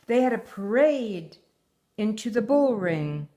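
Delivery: put-on reading a picture book aloud to her child; story reading. A narrator's reading. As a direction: tell the tale gently, like a bedtime story. Mother reads story aloud.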